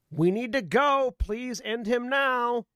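pleading character voice. Acted. Player voices character's urgent plea.

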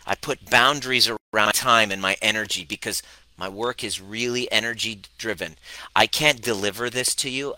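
ordinary talk

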